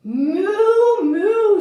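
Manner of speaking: loud, high storybook moo; long, swooping cow call. A narrator's reading. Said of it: "said the cow".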